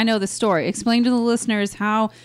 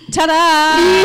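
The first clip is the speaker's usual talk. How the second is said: louder playful ta-da; mock reveal; 4 semitones higher, 5 dB louder than usual. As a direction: announce the twist like a magic trick.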